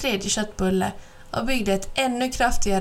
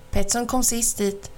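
plain reading voice

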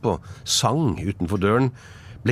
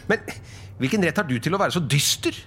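high, quick, lively comeback; retorting nephew; 8 semitones higher, faster than usual. Acted. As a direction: counter him brightly with a question. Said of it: "repliserte Fred". (lyst, kjapt og livlig svar)

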